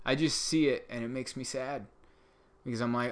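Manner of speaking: ordinary delivery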